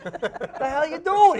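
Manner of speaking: slow, high, loud exasperated yell. Retold or imitated. Dragged behind the boat, yelling at the governor. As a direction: bellow it out in disbelief. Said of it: "yelling, swearing at him".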